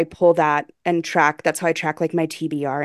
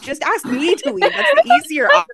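much higher, hammed-up offended whine; mock wounded whine; 11 semitones higher, 3 dB louder than usual. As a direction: whine it like a dramatically wounded guest. Mock offense, friends joke leaving.

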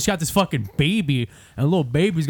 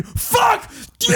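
imitated scream. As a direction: scream it like the enraged man behind glass. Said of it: "he's screaming".

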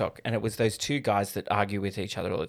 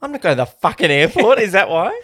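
higher, louder send-up of a quote; loud mock incredulity; 8 semitones higher, 8 dB louder than usual. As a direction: blurt it out in exaggerated mock disbelief. Mockingly exaggerates the kid's line.

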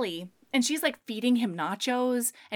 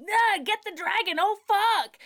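panicked cry; 9 semitones higher than usual. Retold or imitated. Panicked mage voice.

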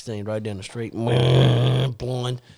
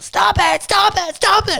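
put-on high, yelped pleas of a kid; kids begging; slower than usual. Boys plead with Dad during the belting, high yelps. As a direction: yelp it like a kid getting the belt. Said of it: "We'd be like".

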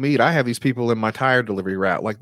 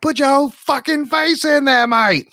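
Gordon Ramsay rant; 6 dB louder than usual. Retold or imitated. Imitating Gordon Ramsay berating a cook. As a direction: tear into a cook like an angry TV chef. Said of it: "There's two different Gordon Ramsays".

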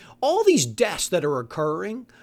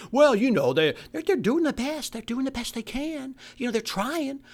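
mock apologist; 5 semitones higher than usual. Mocking imagined apologist.

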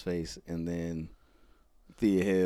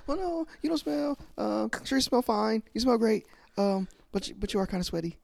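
much higher, tripping over words; flustered voice; 14 semitones higher, faster than usual. Retold or imitated. Wrestler backpedalling after offending a girl, much higher. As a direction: backpedal nervously. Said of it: "He's like".

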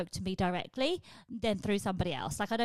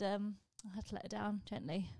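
ordinary talk

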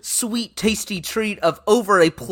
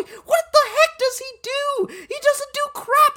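much higher squeaky cartoon voice; mocking squeak. Host ridiculing Marty Smith in a squeaky, much higher voice.

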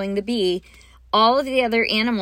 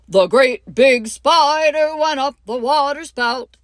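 louder, higher, booming big spider voice; great big spider; 5 semitones higher, 7 dB louder than usual. Shown as a demonstration. Teacher demoing the biggest spider for the class, loud and big. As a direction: make the spider huge and loud. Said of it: "And I get louder as we go".

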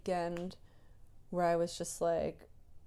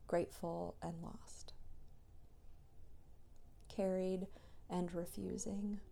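whisper; 8 dB quieter than usual. Real, not acted. Whispered reflective voice memo.

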